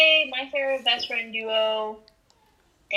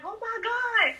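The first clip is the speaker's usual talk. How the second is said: voicing a character; 11 semitones higher than usual. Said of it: "So he's like".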